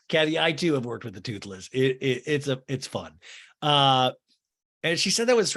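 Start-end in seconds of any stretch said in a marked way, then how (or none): none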